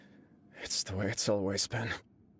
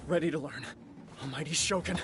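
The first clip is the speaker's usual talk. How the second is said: raised, higher battle-ready reply; determined call; 5 semitones higher than usual. Acted. Young heir answering the Shogun's duel, resolute and raised. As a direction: steel yourself and answer the challenge.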